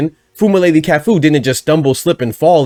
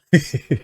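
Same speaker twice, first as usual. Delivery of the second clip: sudden laugh; 4 dB quieter than usual. Real, not acted. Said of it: "I don't know why I just laughed".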